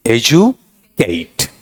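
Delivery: very slow, drawn-out एजुकेट, e-ju-keit; model pronunciation. Shown as a demonstration. Teacher answers pronunciation question, slow model एजुकेट. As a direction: stretch each syllable of एजुकेट. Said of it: "इसका उच्चारण क्या रहेगा".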